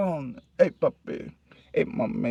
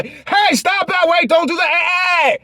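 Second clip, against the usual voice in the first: calling out stop; 11 semitones higher than usual. Acted. Acted telling someone to stop.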